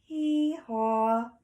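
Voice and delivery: high, loud hee-haw; donkey bray. Acted. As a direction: imitate a donkey, drawing out both notes. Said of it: "A donkey says".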